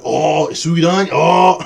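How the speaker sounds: loud, high drawn-out delight; mock rapture. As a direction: swoon loudly over the flavor. Acting out delight at sauce.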